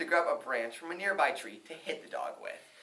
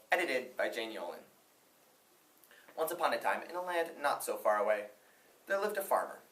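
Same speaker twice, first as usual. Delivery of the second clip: usual speaking voice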